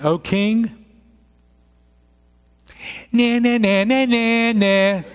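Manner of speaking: silly high nah nah nah; mock Daniel's reply as nonsense babble. Preacher mocking Daniel's answer to the king, playful high babble. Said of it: "Is that what he does? No".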